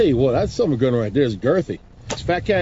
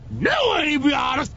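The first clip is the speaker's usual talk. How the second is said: emphatic shout; 8 semitones higher than usual. Acted. Comic pitchman yells slogan.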